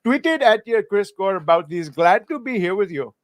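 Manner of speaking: put-on comic high Indian-accented reading. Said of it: "read some super chats in the voice".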